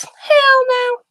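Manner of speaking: high, loud cry of hell no; mock outraged older man shouting no; emphatic refusal. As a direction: belt out an outraged no. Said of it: "And he goes".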